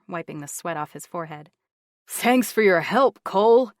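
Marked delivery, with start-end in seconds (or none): 2.1-3.7 loud, high mock thanks; sarcastic holler. Jarrett yelling mock thanks to Cole in the next room. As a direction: yell the thanks with a sneer in it. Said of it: "he hollered in a sarcastic voice".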